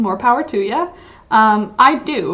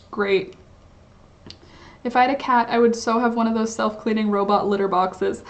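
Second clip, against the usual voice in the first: normal talking voice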